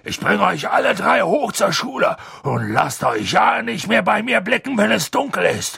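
high, shouted warning easing at the end; irate big-man bellow settling into gruffness; angry scolding. A narrator's reading. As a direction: lay down the law furiously, then drop back. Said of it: "sagte Hagrid zornig".

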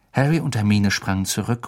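usual speaking voice

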